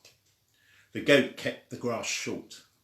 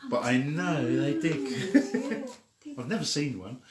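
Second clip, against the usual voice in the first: laughing. Real, not acted. Laughs while admitting ignorance.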